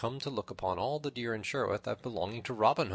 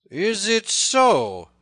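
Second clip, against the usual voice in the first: very slow, high, loud challenge; enraged cry; 12 semitones higher, 14 dB louder, much slower than usual. A narrator's reading. Little John answers the tanner's boast, crying in a rage. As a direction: draw it out in outrage. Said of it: "cried Little John in a rage".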